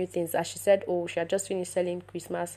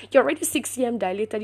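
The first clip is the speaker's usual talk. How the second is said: quick, higher, insistent restatement; emphatic insistence; 4 semitones higher, 4 dB louder, faster than usual. Retold or imitated. Doctor repeating the dilation news to patient, emphatic insistence. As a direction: drive the fact home, fast and raised.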